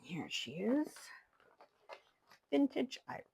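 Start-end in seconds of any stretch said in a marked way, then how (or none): 0.0-0.9 whisper. Real, not acted. Presenting her journal in whisper.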